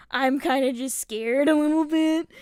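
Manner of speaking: hammed-up rattled admission; retold frightened confession. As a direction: blurt out the fear loudly, half laughing. Retold shaky confession, breaking into laughter.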